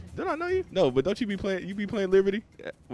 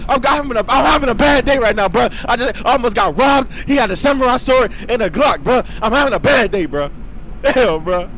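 shouting; 11 semitones higher, 14 dB louder than usual. Real, not acted. Complaining loudly to other player.

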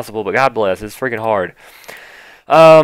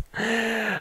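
short squeal of a laugh in disbelief; high squeaky laugh; 12 semitones higher, 12 dB quieter than usual. Real, not acted. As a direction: let out a tiny high giggle. Reacting to ugly update.

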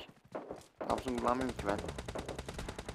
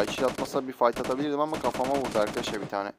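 normal talking voice

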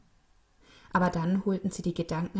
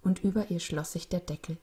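usual speaking voice

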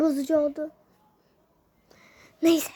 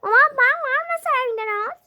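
high, loud put-on voice talking back; the items squeak back indignantly; squeaky answering voice; 9 semitones higher, 5 dB louder than usual. The unboxed items talking back to the kid, high indignant voice.

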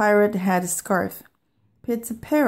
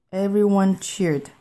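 usual speaking voice

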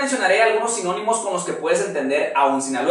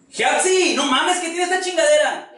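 much higher yelled complaint; hammed-up yell, higher; 10 semitones higher than usual. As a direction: yell at her about the broken thing. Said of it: "Gritas, cabrón".

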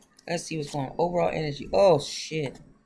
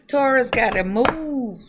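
laughing; 6 semitones higher, 7 dB louder than usual. Real, not acted. Laughing about the moon sign.

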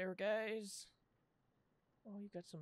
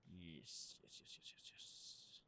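whispered yeses; 13 semitones lower, 17 dB quieter, slower than usual. Real, not acted. Muttering while checking clipboard.

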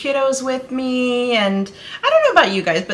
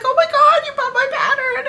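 very high, imitated squeal; excited; 3 dB louder, faster than usual. Designer thrilled by a customer's purchase, excited squeal. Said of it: "she got excited".

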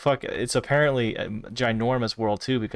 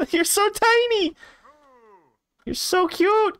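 cooing squeal; 4 dB louder than usual. Real, not acted. Streamer coos at a tiny friend in a high squeal. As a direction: gush over a tiny cute thing.

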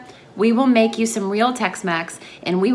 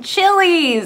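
proud friends; 9 semitones higher, 6 dB louder than usual. Retold or imitated. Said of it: "They're like".

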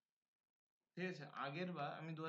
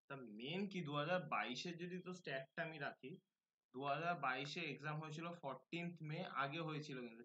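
usual speaking voice

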